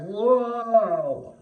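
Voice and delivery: high, awestruck exclamation; as if hit by a wave of feeling; overwhelmed gasp of wonder. Retold or imitated. Overwhelmed moment of awe retold.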